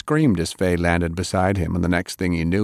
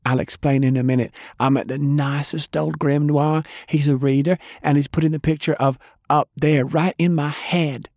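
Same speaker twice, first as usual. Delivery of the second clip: put-on higher, fast bubbly girl; excited Fay; 8 semitones higher than usual. A narrator's reading. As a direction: rattle it off excitedly.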